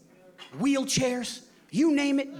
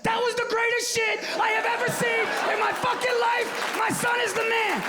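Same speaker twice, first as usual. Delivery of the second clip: much higher, louder, slow shouted joy; ecstatic shout; 4 dB louder than usual. Retold or imitated. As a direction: scream with proud delight. Inner thought, thrilled pride.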